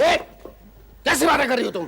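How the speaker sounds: indignant question at full voice. Acted. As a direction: challenge them without lowering your voice. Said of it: "ये नीचे आवाज में बात कर".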